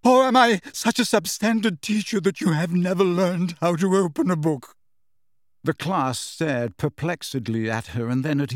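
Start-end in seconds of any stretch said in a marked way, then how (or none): 0.0-4.8 high, loud, wounded outburst; high-pitched, somewhat hysterical. A narrator's reading. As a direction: let hurt pride tip into hysteria. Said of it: "her voice high-pitched and somewhat hysterical".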